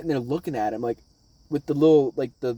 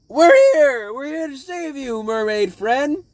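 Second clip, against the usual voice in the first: high-pitched triumphant rescue cry; heroic rescuers; 10 semitones higher, 7 dB louder, slower than usual. Retold or imitated. Crew crashing in to save the mermaid, high triumphant cry. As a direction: call it out high, stretching the last words. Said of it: "they're like".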